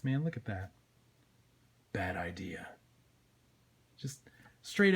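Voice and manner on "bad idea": low, soft warning; dark lowered antihero voice; menacing Spawn. Acted. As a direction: say it deep and quiet.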